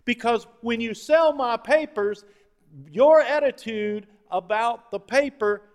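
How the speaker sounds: newspaper boss laying down terms. Retold or imitated. Newspaper tycoon on feeding his newsies, loud and insistent. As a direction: lay down the rule forcefully. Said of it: "because he said this".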